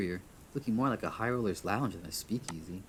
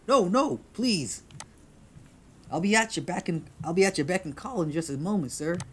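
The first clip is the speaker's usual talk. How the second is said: loud, much higher hurried-host shout; male bartender calling; 9 semitones higher, 7 dB louder than usual. Acted. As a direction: shout pleadingly from the back room. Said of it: "a male voice, deep".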